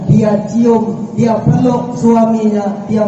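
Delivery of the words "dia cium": ordinary talk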